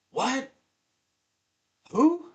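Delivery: high, sharp startled questions; confused surprise. Retold or imitated. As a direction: caught off guard on the phone. Drunk Chip on phone, confused.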